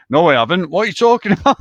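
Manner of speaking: loud, rising protest; indignant denial. Retold or imitated. Lad denying he sounds like a pop singer, indignant. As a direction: hotly deny it, voice climbing.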